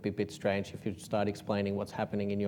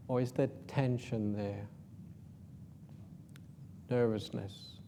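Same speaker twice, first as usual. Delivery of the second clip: guided meditation; 8 dB quieter than usual. Acted.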